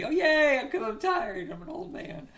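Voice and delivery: high, put-on whiny cheer; mock cheer. Host joking that they are old and tired, mock cheer.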